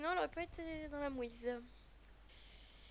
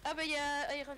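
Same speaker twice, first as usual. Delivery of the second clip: ordinary talk, nothing marked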